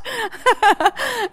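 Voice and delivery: high-pitched giggling burst; high laughter. Real, not acted. Preacher tickled by Jesus saying rest, laughing high. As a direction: laugh freely after the punchline. Laughing at her own story.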